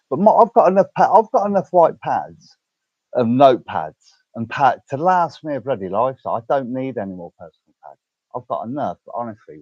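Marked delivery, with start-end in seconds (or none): 0.1-6.2 heated rant. Real, not acted. Ranting about having enough pads.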